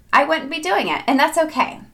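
doing lifted high and bright; I wouldn't be doing it sung up high. Shown as a demonstration.

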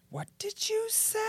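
very high, soft ghost voice; ghostly whisper. Shown as a demonstration. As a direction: barely breathe it, high and eerie.

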